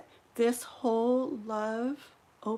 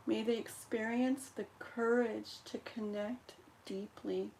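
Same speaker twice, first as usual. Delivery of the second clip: prayer recitation; 4 dB quieter than usual. Acted. Recites healing prayer over candle.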